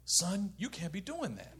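soft, slow mock-parental talking-to; mom's gentle reproof. Mom sitting her son down, soft slow reproof. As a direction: imitate a mother quietly correcting her child. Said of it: "my mom sits me down".

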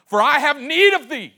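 loud ringing preacher's cry; full-voiced sermon cry; preaching at full force. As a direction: proclaim it to the church.

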